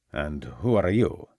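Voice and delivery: a guarded servant in a hint of Italian accent. Servant questioning the inspector at the door, slow light Italian accent. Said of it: "in what Ravenscroft judged to be an Italian accent".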